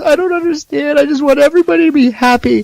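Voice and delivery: high, teary imitation of a gentle big guy; crying hippie voice. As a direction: whine it like a sobbing peace-lover. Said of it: "He goes".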